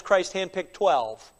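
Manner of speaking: normal talking voice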